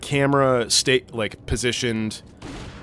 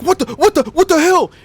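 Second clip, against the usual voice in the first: high, loud, stammered alarm; imitated panic; 8 dB louder than usual. Retelling a man hearing the camera shake, imitated panicked yelling. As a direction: act out his rising fright, repeating the first words. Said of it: "and he was like".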